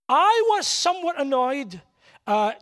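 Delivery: usual speaking voice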